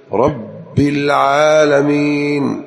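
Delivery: رب with a held double ب, very slow; high, drawn-out geminated رب العالمين; doubled ba. Shown as a demonstration. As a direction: press the ب of رب, stretch العالمين. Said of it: "ثم يشدد في الباء".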